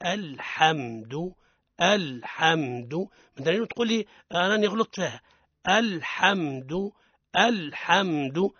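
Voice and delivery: slow, careful الحمد repeated for practice; drill repetition. Shown as a demonstration. Teacher leading older listeners in slow repetition. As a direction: model الحمد slowly for listeners to copy. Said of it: "يعاودوا معانا".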